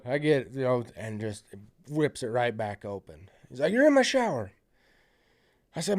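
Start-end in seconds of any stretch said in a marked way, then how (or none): 3.7-4.5 high, loud imitation of a protesting intruder; high-pitched mock complaint; mock indignant outburst. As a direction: blurt it out high, playing the intruder. Acted shout of an intruder.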